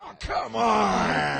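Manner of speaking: groaning outcry. Real, not acted. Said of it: "reacts to the story".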